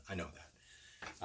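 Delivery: slow unvoiced aside; whispered aside. Real, not acted. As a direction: breathe it out as a private admission. Aside admitting the mess.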